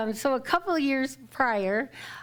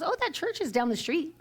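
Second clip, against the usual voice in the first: higher-pitched, sing-out acted quote; retold chirpy helpfulness; 7 semitones higher than usual. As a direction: imitate someone eagerly pointing it out. Retelling stranger's chirpy helpful remark.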